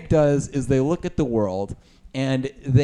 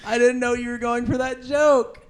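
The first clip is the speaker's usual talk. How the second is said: laughing apology; 12 semitones higher than usual. Real, not acted. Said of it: "So sorry".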